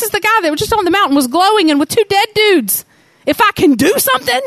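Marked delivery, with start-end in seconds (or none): 3.3-4.4 high, fast mock disbelief; incredulous. Preacher repeating the father's doubt, incredulous and high. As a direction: throw the father's doubt back, amazed.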